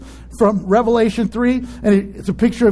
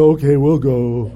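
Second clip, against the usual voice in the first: reluctant; 10 semitones lower than usual. Retold or imitated. Reluctantly agrees next week.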